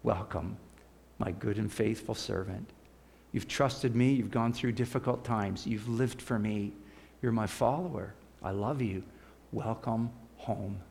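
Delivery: hushed loving Jesus; tender welcome. Retold or imitated. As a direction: welcome them home gently. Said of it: "Jesus just goes".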